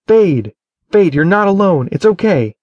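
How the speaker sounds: high, loud, strained reassurance; urgent calls to pull him back; tight voice. A narrator's reading. Edric calls Falcon by the wolf's name, high and loud. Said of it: "his voice tight".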